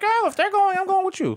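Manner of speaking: very high cartoon girl voice; high-pitched mock-female voice; Daisy. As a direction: squeak it like a female cartoon duck. And then Daisy just came.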